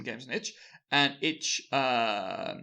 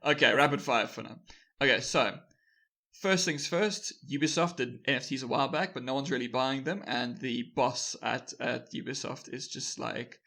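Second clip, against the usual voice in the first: ordinary talk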